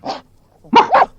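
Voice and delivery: very high, loud playful woof; high yappy dog woof; dog bark. Acted. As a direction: bark like a happy spaniel. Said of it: "he said to Janet".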